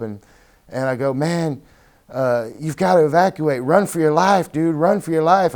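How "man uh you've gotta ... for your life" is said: urgent shouting, pitch up high; retold screaming. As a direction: yell at him to run for his life. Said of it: "I just started screaming at him".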